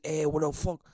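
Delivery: a hint of an Italian-American dad, gruff; light Italian accent on the "Eh". Imitating his Italian dad's accent, annoyed. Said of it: "he talks, he's from Italy".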